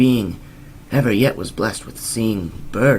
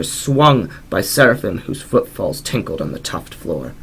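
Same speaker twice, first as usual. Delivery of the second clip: plain reading voice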